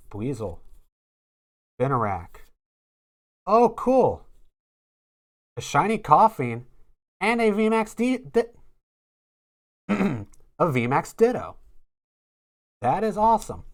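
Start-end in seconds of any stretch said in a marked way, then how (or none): 3.5-13.6 high, thrilled discovery; excited. Real, not acted. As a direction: gush over the shiny card. Shiny pull reaction.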